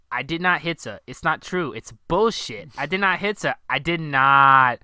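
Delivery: loud, rising mock outburst, building to a shout; Tommy's angry denial. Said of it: "he says".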